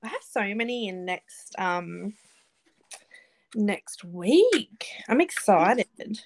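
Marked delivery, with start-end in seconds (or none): none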